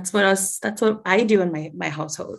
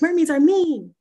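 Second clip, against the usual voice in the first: whiny fisherman; 9 semitones higher, 5 dB louder than usual. Retold or imitated. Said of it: "then he's like".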